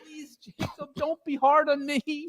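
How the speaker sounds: high, hushed frightened plea; pleading Scrooge. Acted. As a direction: beg the ghost to go easy.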